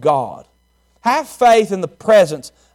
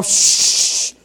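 long unvoiced shushing; imitating a mother's drawn-out shh; mom's hush. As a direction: shush a noisy child. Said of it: "And her mom".

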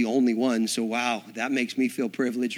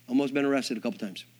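ordinary delivery

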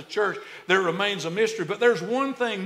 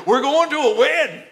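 Alabama accent, "going" with the G dropped, high and louder; Alabama drawl; 8 semitones higher, 7 dB louder than usual. Shown as a demonstration. Preacher showing how an Alabama boy says it, Alabama accent. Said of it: "say it like an Alabama boy".

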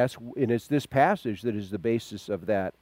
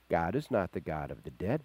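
usual speaking voice

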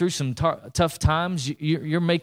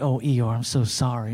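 lower, soppy apology; mock pity; 5 semitones lower than usual. Preacher mimicking someone picking Eeyore back up, mock-tender. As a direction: imitate someone caving in with sympathy. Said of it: "Don't be like".

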